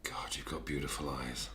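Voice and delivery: soft seductive whisper. Acted. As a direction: breathe it close, seductive. Sudden seductive move on therapist.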